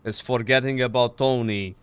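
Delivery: lightly voiced Maltese cop; Maltese accent. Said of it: "Can you R P your character again?".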